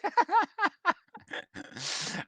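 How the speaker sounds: real laugh that shoots high and loud; high burst of laughter. Real, not acted. Host laughing at an overdone press release.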